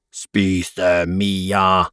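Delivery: dark monster voice, held back in volume; mock monster roar. A narrator's reading. Adam plays monster to eat Mia, dark restrained roar. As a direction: growl it as a pretend monster. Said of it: "brøler han med den mørkeste stemmen han har".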